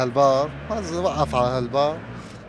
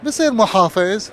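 rising higher and louder to the last line; building punchline; 7 semitones higher, 4 dB louder than usual. Acted. Man answers the governor, building louder to the punchline.

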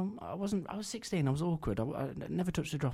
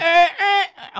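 Gary Poundland impression; 17 dB louder than usual. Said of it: "Let's hear it again".

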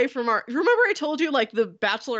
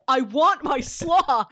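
slow, higher retold shout; mock shout; 5 semitones higher, 4 dB louder, slower than usual. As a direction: stretch out a customer's mock-shouted demand. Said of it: "Just shouting".

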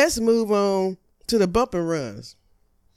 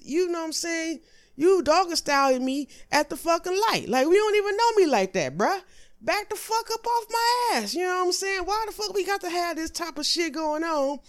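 high exasperated rant; 10 semitones higher than usual. Acted. Ranting at the tailgater, high comic outrage. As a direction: voice jumps much higher in comic outrage.

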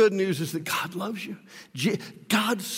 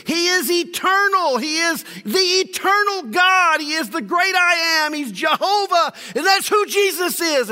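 higher, louder proclamation; full-force preaching; 12 semitones higher, 7 dB louder than usual. Acted. Preacher proclaims Jesus as eternal God, loud and high. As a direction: thunder it out from the pulpit.

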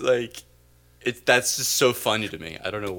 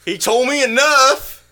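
high, loud, slow Southern twang; over-the-top Southern hero; hammed-up Deep South Luke; 14 semitones higher, 10 dB louder, slower than usual. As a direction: Star Wars as if shot in the Deep South. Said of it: "filmed instead in the Deep South".

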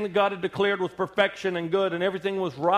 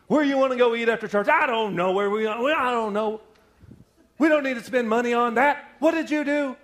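bickering spouses; 6 semitones higher, 4 dB louder than usual. Retold or imitated. Couple bickering in the car.

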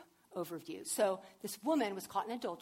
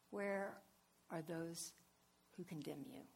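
tender; 4 semitones lower, 8 dB quieter than usual. Retold or imitated. Jesus speaks tenderly to woman.

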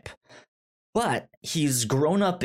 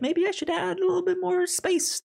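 mock author's thought; faster than usual. Author's imagined thought, put-on voice.

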